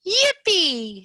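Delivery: high, loud yippee; excited Froggy. Acted. Froggy bouncing out of bed, high excited yippee. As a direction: cheer it like a kid jumping up.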